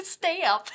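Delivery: high, giggly STAY UP for "step"; laughing self-mockery. Host copying her own slip, giggling mock "stay up". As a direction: laugh through a mangled "stay-up". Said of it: "Is that what I said?".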